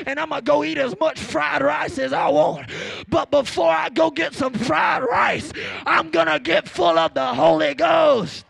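high, loud preacher shout; full-force preaching. Real, not acted. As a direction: drive each phrase home at full volume.

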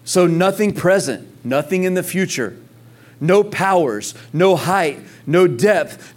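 slow, loud, emphatic preaching. Acted. Pastor proclaiming scripture to the church, slow loud preaching. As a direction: proclaim each line to the congregation, slowly and loudly.

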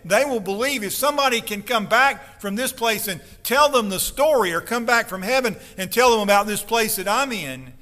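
loud begging that climbs with each at home; a tormented man shouting his plea to Abraham; desperate plea. Acted. Rich man begs for his brothers.

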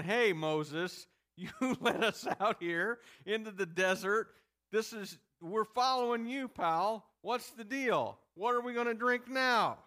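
hammed-up protest building in pitch; grumbling complaint. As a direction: complain at the leader, mock indignant. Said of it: "they start grumbling and complaining".

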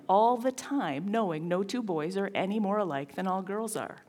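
earnest spoken performance piece; audition monologue. Acted. Actor performs her audition piece to the audience, earnest and building. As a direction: deliver it like a heartfelt stage monologue.

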